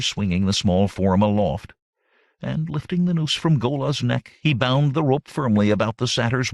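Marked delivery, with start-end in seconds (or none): none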